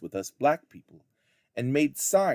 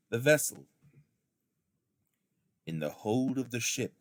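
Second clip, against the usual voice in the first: ordinary delivery, nothing marked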